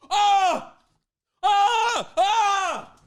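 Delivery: loud high staged yelps; hammed-up "Ah!" screams; mock cries of pain. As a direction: yelp as if putting on pain for attention. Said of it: "You're like".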